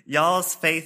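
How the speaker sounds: "Y'all's" punched high and loud; amused stress on Y'all's. Acted. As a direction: lean hard on "Y'all's", amused by the word. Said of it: "he does say y'all".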